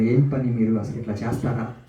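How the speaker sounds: usual speaking voice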